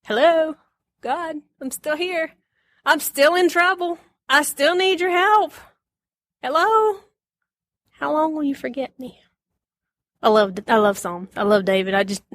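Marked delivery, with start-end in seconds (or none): 0.0-9.2 high, pleading call to God; pleading psalmist. Retold or imitated. David begging God not to forget him, high and pleading. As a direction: plead to be remembered. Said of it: "David says".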